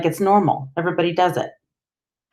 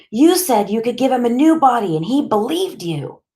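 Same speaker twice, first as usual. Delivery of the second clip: high, pleading reproach; upset accusation; 8 semitones higher, 3 dB louder than usual. A narrator's reading. Boy blaming the doctor for his friend, upset accusation. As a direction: press the accusation in a raised pitch.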